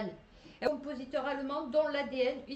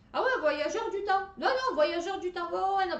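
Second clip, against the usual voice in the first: excited exclamation; 7 semitones higher, 6 dB louder than usual. Real, not acted. Excited reaction to article.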